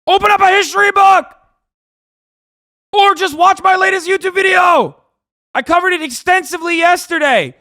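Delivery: real fed-up outburst; exasperated shouting. Real, not acted. Streamer to latecomer asking for a rehash, exasperated shouting. Yelling at viewer who wants rehash.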